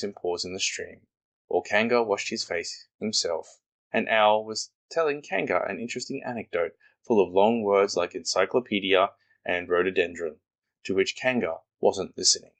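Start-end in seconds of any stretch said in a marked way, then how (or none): none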